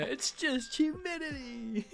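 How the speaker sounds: very high, slow mock voice; playful punchline imitation. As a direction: stretch it out high and silly. Repeats the skit's punchline playfully.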